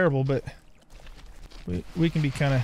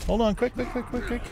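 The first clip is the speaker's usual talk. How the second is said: high, rapid urging; urgent hurry; 7 semitones higher than usual. Real, not acted. Player rushing to capture the taskmaster, urgent hurry. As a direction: hurry yourself along, almost pleading.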